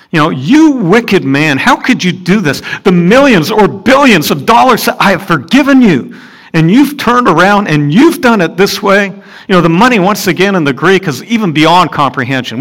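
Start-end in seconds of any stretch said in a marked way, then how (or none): 0.3-9.1 loud, higher indignant rebuke; angry master. Acted. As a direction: blast him for his ingratitude. Preacher voices the master's rebuke.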